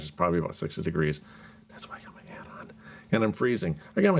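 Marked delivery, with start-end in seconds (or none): 1.7-2.7 breathless quick whisper; whispered aside. Real, not acted. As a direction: whisper it fast under breath. Sudden aside to camera.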